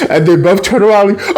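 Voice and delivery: ordinary talk, nothing marked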